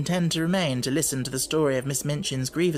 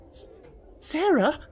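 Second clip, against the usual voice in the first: slow, much higher faltering; frightened stammer; 13 semitones higher, slower than usual. Acted. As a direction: stumble on the name in fear. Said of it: "she stammered".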